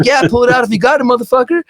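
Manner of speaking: high and fast, rowdy and playful; rowdy rocker. Retold or imitated. Dime telling the fan to light it up, rowdy and fast. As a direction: toss it off like a party guy. Dime accepts weed offer.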